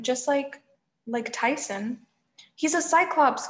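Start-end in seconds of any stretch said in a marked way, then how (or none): 2.5-3.5 higher, urgent caution; warning. A narrator's reading. Grover cautioning the hero about Cyclops, urgent high warning. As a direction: warn a friend urgently. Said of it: "Grover warned".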